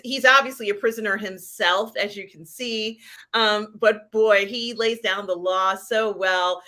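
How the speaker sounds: ordinary talk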